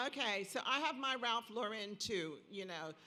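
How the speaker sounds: usual speaking voice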